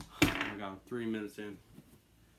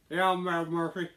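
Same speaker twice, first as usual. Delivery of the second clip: loud, higher-pitched Irish gangster boast; attempted Irish mob boss; 6 semitones higher, 9 dB louder than usual. Host introducing his gangster character, amateur Irish mob boss. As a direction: introduce yourself as a cocky Irish mobster. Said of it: "like, a, an Irish mob boss".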